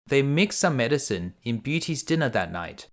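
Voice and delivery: usual speaking voice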